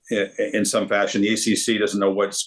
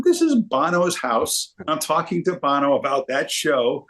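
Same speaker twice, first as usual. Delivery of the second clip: higher, amazed inner voice; starstruck disbelief; 6 semitones higher than usual. Retold or imitated. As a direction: relive the wide-eyed can't-believe-it moment. Recalling awe at [name]'s house.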